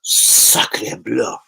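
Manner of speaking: lightly put-on French accent, low and understated; a casual French exclamation in a faint French accent; mock French. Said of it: "Am I fully French today?".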